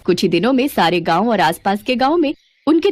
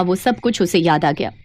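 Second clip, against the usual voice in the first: ordinary delivery, nothing marked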